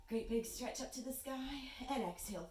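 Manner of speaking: usual speaking voice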